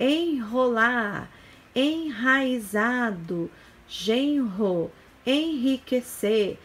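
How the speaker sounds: "enrolar, enraizado, genro, enriquecer" with a strong h-like R after each N; R forte após N. Shown as a demonstration. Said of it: "o R representa um som forte". ("enrolar, enraizado, genro, enriquecer" devagar, com erre forte e aspirado)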